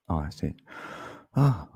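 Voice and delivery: ordinary talk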